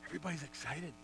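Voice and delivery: normal talking voice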